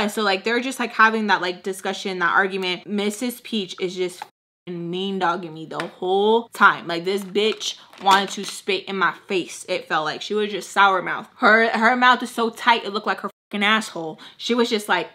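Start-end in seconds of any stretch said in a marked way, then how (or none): none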